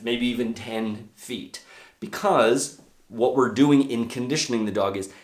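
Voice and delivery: usual speaking voice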